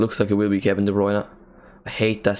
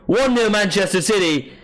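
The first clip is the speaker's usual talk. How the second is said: excited goal call; 11 semitones higher than usual. Real, not acted. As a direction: belt out the goal for City.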